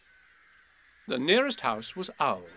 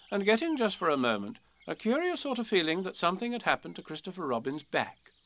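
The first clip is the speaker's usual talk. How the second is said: ordinary delivery, nothing marked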